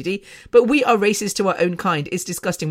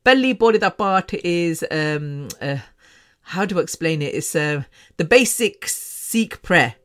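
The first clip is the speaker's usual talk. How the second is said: ordinary talk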